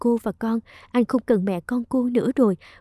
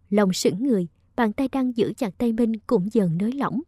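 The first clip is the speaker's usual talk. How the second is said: usual speaking voice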